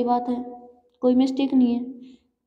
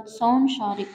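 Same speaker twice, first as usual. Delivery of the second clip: ordinary talk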